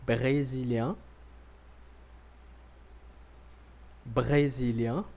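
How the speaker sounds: brésilien, nasal ending, slow with pauses; brésilien said twice, slowly; model for repeating. Shown as a demonstration. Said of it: "repita comigo".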